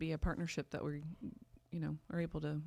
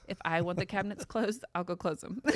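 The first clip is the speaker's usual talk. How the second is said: laughing; 2 semitones higher, 7 dB louder than usual. Real, not acted. Laughing while saying.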